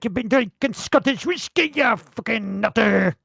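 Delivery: put-on a rough amateur Scottish accent, scolding; mock Scottish wife. Retelling how his wife scolded him, in a bad Scottish accent. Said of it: "your Scottish accent's terrible".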